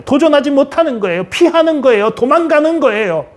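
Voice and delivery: high, insistent list of charges; forceful preaching. Real, not acted. Preacher confronting timid believers about running away, forceful preaching. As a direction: drive each verdict home.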